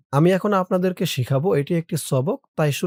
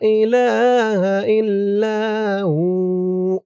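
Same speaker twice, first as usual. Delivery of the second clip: very slow chant, higher and louder; tajweed recitation; 4 semitones higher, 4 dB louder, much slower than usual. Shown as a demonstration. Teacher showing where to stretch each sound. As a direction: draw out ইলাহা and ইল্লা, stop on হু. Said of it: "এখান থেকে আমার সাথে বলুন".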